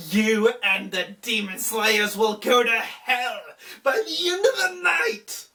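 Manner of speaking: a hammed-up villain taunting, with laughs in it; mock villain. The demon king taunts the hero with death. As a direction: sneer the threats like a gloating demon lord.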